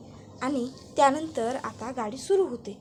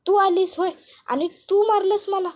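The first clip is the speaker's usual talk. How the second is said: higher and louder, stung and furious; outraged cry; 7 semitones higher, 6 dB louder than usual. A narrator's reading. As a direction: lash out at the one who hit her. Iravati hit, turns on Seema. (मार बसल्यावर संतापाने किंचाळणारी इरावती)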